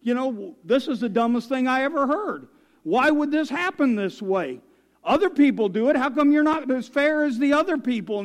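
high, loud hypothetical fit; mock angry yelling. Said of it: "yelled and screamed and said".

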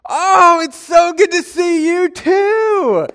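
very high, loud, drawn-out mock delight; fake excitement. Faking recognition of a woman at Christmas, wildly over-excited. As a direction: overdo the gushing, stretch the words out. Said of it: "I acted all excited to see her".

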